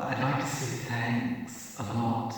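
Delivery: slow sassy drag queen take. Retold or imitated. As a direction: camp it up, drawn out. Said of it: "I've gotta get it right".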